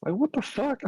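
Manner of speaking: high-pitched what the fuck; incredulous high exclamation; shocked disbelief. Retold or imitated. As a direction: squeak it out in shock. Shocked retelling dog death.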